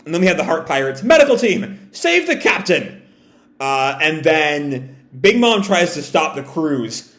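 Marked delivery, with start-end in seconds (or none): 1.0-3.0 urgent call. Acted. Heart Pirates call for help.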